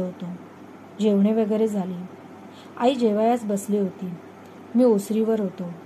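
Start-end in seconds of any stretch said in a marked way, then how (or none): none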